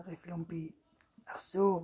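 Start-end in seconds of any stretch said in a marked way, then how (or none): none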